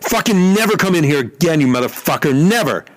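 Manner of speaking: very high imitated scream of rage; high shrieked fury; mock screaming. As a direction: blow up like the raging pitcher. Rocker screams at him.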